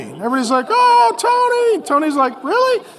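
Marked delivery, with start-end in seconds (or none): 0.7-1.8 high, drawn-out mocking oh Tony; teasing family. Relatives at Thanksgiving teasing Tony, high and slow. 2.4-2.8 high incredulous really; indignant Tony. Retold or imitated. Tony protesting his family's roast, high and incredulous.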